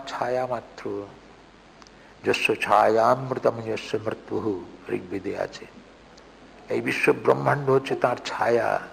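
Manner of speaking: measured, grave reading of scripture-like prose; soft, solemn declamation; solemn recitation. Acted. Reciting Vivekananda's text aloud.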